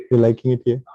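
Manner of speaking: normal talking voice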